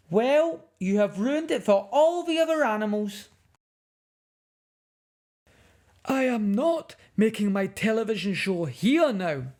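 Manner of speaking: high, loud, indignant man scolding; furious filmmaker. Acted. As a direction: tell off the naughty cub in outrage. Filmmaker refuses to film.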